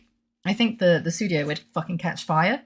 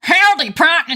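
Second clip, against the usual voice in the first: high, loud cowboy holler; trial cowboy voice; 12 semitones higher, 9 dB louder than usual. Acted. Streamer trying out a voice for a new character, hammed-up cowboy. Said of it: "what's this guy's voice gonna be like?".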